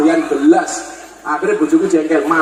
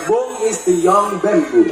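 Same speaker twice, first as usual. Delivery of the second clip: slow explaining; slower than usual. Retold or imitated. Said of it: "Saya jawab".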